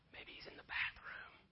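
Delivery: whispered jab; sly mocking whisper; taunting whisper. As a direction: whisper the taunt like a secret joke. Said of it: "Elijah is taunting".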